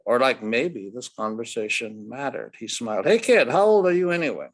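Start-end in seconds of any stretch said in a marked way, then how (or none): 3.1-4.5 put-on higher, louder blunt ballplayer lecturing a kid; a brash old ballplayer talking down to a boy; gruff Ted Williams. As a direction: brush the kid off, then lay out the facts. Ted voice again.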